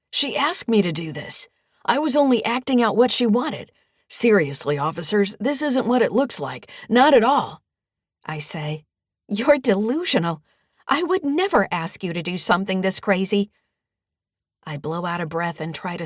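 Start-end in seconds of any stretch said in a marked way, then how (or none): 0.1-7.6 loud, higher-pitched spluttered excuse; spluttering protest. Acted. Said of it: "Dusty splutters". 9.3-13.5 loud, higher-pitched indignant retort; outraged denial. Acted. Mother rebuts her son's accusation.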